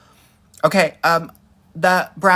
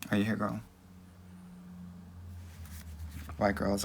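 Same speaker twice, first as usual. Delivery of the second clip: much softer, dropped low, barely bothering; dismissive hush; 5 semitones lower, 16 dB quieter, faster than usual. Pretend. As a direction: mutter the roll as if they don't matter.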